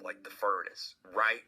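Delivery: normal talking voice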